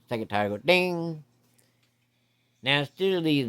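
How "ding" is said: hose bell ding. Retold or imitated.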